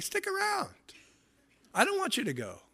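soft, very high begging voice; mock pleading. Party host to guests who are leaving, playfully pleading. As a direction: coax guests in a small, high voice.

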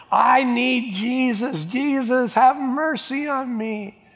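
high, drawn-out plea that won't be distracted; acted desperate cry for help, stretched out; freaking out, pleading. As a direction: wail it slowly, begging for mercy. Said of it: "He just kept freaking out".

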